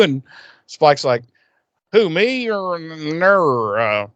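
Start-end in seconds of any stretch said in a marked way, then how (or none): 1.9-4.1 drawn-out, badly faked Southern accent. Spike passing himself off as Xander's pal, bad Southern accent. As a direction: put on an unconvincing Southern drawl. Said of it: "put on this really bad fucking, like, Southern accent".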